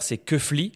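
« keufli » en deux syllabes, keu puis fli; flic à l'envers. Shown as a demonstration. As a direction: dire « keufli » lentement, syllabes inversées de flic. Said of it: "Flic à l'envers". (« keufli », prononcé à l'envers en verlan)

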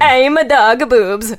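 slow, loud singsong Swedish; mock Swedish accent. Shown as a demonstration. Co-host dared to do Jamaican, lands on Muppet Swedish accent. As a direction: Swedish Chef style Swedish accent. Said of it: "That was Swedish".

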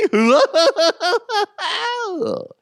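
high, loud clown laugh after the pitch; sinister clown giggle; creepy clown cackle. Acted. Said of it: "That's my creepy clown voice".

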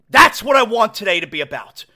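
ordinary talk

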